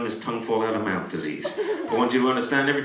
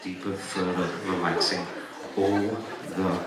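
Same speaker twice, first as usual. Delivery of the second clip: low, very slow trance voice; hypnotic soothing; 8 semitones lower, 4 dB quieter, much slower than usual. Real, not acted. Hypnotist sending volunteers deeper into sleep, hypnotic soothing. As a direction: ease them down, long gaps between words.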